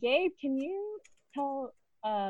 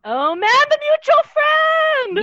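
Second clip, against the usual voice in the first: loud, high, drawn-out exclamation; excited shout; 14 dB louder, slower than usual. Real, not acted. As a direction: shout it out in sudden realization. Sudden realization, excited outburst.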